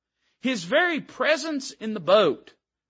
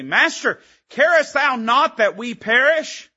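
accusing plea; 8 semitones higher, 7 dB louder, slower than usual. Acted. Disciples accusing Him.

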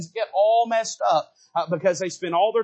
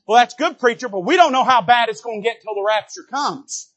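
a touch louder, higher mock congregation; 5 semitones higher, 7 dB louder than usual. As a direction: voice the crowd's worry a bit raised. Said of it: "Here's what we all say".

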